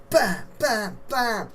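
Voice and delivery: high, loud "bam" on each blow; imitated impact noise. Wrestler narrating repeated bin lid blows, imitated impacts. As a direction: punch out mock hits.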